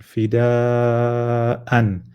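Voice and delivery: "Fida'an" with a very long aa before the final an; slow recited demonstration of "Fida'an"; pulled dal. Shown as a demonstration. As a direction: hold the aa of "Fida'an" long, then close on an. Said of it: "pulling your dal".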